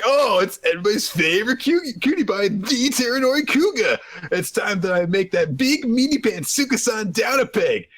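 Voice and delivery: high-pitched show-off impersonation; Terenori Kuga. Acted. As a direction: play a hammed-up self-styled cutie pie. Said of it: "He was mimicking what Kuga was doing".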